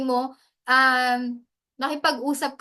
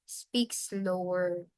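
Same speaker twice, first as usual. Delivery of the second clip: slow, drawn-out demo of slow speech; softer, slow demonstration of a slower tempo; speaking slower; 5 semitones lower, 9 dB quieter, much slower than usual. Shown as a demonstration. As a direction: stretch the words to show slowing down. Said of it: "if you want to catch audience's attentions".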